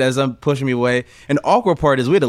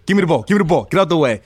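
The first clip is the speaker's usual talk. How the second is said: a touch louder, higher calling for the ball; lightly voiced teammate demanding the ball; 5 semitones higher, 3 dB louder, faster than usual. [name] calling for the ball, mildly raised.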